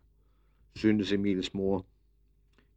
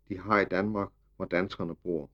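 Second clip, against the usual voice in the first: usual speaking voice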